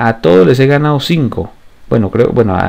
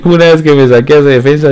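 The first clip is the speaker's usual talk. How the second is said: laughing; 3 semitones higher, 3 dB louder, faster than usual. Real, not acted. Laughing while recounting the play.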